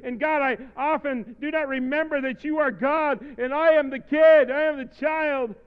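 loud, mounting confession cried out to God; impassioned confession prayer. Real, not acted. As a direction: build steadily to a high shout.

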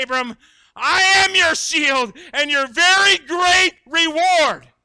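preacher rising to a roar; forceful preaching. Acted. Preacher builds to full force.

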